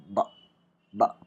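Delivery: ब said with both lips closing, then a voiced release; a short voiced ब with a lip stop; lips blocked b sound. Shown as a demonstration. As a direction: press lips shut and let the voiced ब pop out. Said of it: "block होता है".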